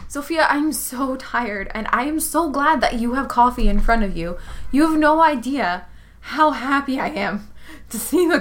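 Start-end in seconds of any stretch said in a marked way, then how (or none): none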